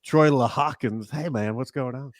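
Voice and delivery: lightly voiced hammy broadcaster greeting; Harry Carey voice. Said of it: "I do Harry Carey".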